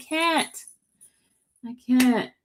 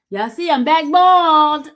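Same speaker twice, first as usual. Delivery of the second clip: loud, higher, proud reveal; excited announcement; 6 semitones higher, 8 dB louder than usual. Real, not acted. Proudly showing new bald head.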